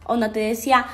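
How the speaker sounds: ordinary talk, nothing marked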